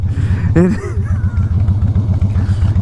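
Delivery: wheezy real laugh. Real, not acted. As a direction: break up at your own story. Laughs at own story.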